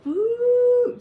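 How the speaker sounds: high, loud sudden ooh; startled ooh. Retold or imitated. Recalling smoke blown in her face, a high startled ooh. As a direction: yelp at the smoke.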